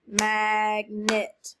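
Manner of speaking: Magnet split into two drawn-out beats, mag, net; clapped syllables. Shown as a demonstration. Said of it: "Clap it".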